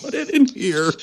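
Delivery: high-pitched comic echo; mock old lady. Co-host echoing the old lady's offer, high mock invitation.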